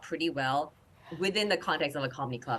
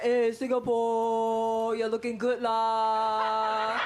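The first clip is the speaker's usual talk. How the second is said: slow, high flirty Singlish tease; flirty Malaysia voice; 7 semitones higher, 4 dB louder, much slower than usual. Acted. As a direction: coo it like a smooth-talking suitor. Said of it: "y'all trying to come around like".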